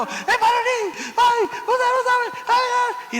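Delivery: high, wailing, tearful pleading; hysterical crying woman. Retold or imitated. Frantic caller begging the radio preacher for help, high and wailing. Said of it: "había esa mujer llorando".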